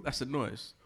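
ordinary talk, nothing marked

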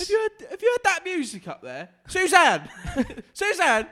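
shouting dad. Acted. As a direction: bellow up the stairs as dad. Grumpy dad yelling at kids.

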